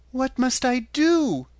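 put-on high, slow child pleading; little girl voice. A narrator's reading.

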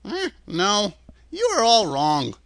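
put-on meek old man, lifted pitch. A narrator's reading. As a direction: correct them timidly, apologetically. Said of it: "said the little man meekly".

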